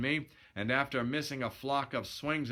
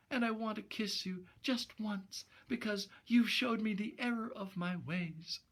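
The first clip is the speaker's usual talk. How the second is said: put-on high, soft girlish voice, quick at times; a sorry dame vowing to go straight; repentant young woman; 9 semitones higher, 3 dB quieter than usual. Gloria confessing to Steve before going home, high soft voice.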